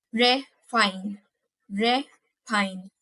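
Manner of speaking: "refine" split as re, pause, fine; syllable by syllable. Shown as a demonstration. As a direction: break "refine" into two separate syllables. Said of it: "I'm pronouncing it by syllables".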